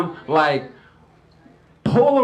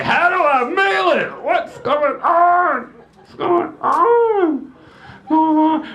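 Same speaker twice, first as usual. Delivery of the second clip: put-on high, slow, bewildered kid; confused daughter; 9 semitones higher, slower than usual. Daughter trying to mail a Polaroid, high and baffled. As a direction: drag it out, lost.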